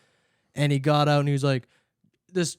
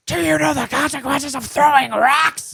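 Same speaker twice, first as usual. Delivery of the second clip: high, loud, drawn-up scolding yell; mock furious yell; 12 semitones higher, 7 dB louder than usual. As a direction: yell it in a kid's face, hammed up. Mock confronting a kid throwing rocks.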